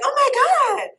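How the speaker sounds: high, loud mock "oh my God"; imitated shocked gasp. Staff reacting to her fur coat, high loud surprise. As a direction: play the stunned onlookers in the clinic. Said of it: "everybody's like".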